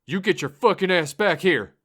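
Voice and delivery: high, loud furious roar. Acted. Driver charging after the narrator, furious roar. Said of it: "he roared".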